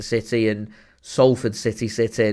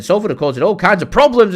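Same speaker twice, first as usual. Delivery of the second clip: higher, louder, fast play-by-play burst; rapid, raised match call; excited commentary; 8 semitones higher, 6 dB louder, faster than usual. Real, not acted. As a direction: get swept up calling the attack. Live reaction to an attack.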